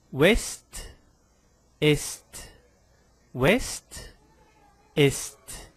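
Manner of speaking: "ouest, est" with final s and t both sounded; each point alone, "ouest" and "est" closing on a crisp st; ST final prononcé. Shown as a demonstration. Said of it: "eu também pronuncio".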